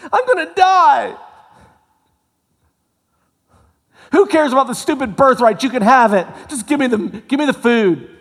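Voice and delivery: high, loud, hammed-up wail; desperate Esau. Esau handing his birthright to his brother, in a high, loud hammed-up wail. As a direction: throw away the birthright in a starving panic.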